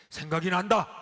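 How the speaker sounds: sudden loud cry, the thought won't stop; a loud burst of helpless frustration; loud outburst. Retold or imitated. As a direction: blurt it out in frustration. Sufferer blurting out.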